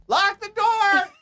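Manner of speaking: high, loud, drawn-out mock shout; imitated yell. Guy inside the house yelling a warning, mock high shout. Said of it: "I hear a guy go".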